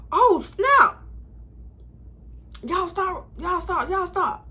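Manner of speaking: surprised director. Retold or imitated. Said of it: "the director was like".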